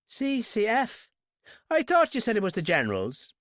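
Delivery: high, doubtful Irish questioning. A narrator's reading. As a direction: query it suspiciously in an Irish accent. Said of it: "as Irish as a sprig of shamrock".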